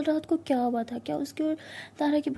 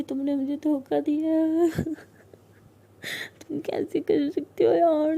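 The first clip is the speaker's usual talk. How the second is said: tearful accusation; 3 semitones higher, 3 dB louder, slower than usual. Acted. Siya accuses Tara of betrayal, crying hard. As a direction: drag the words out through sobs. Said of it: "Siya बहुत ज़्यादा रो रही होती है".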